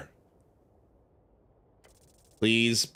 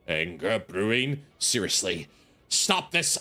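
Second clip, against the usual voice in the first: usual speaking voice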